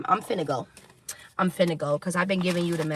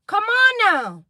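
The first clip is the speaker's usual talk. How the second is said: high, loud exasperated call; exasperated cry; 12 semitones higher, 8 dB louder, slower than usual. Real, not acted. Reader urging her viewers in exasperation. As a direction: throw your hands up in a shout.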